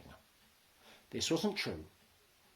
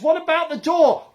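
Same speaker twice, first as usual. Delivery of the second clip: shout; 16 dB louder than usual. A narrator's reading. Shouted exchange in lorry.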